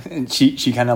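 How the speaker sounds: ordinary talk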